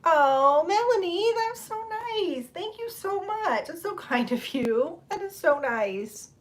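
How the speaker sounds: high, slow touched gushing; gushing thanks. Real, not acted. Streamer gushing high and slow to a viewer's kind note. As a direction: melt over a sweet comment.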